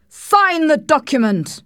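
high, loud, drawn-out command; furious shout. A narrator's reading. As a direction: order it in a slow, furious shout. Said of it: "he shouted furiously".

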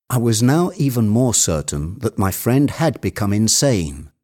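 plain reading voice

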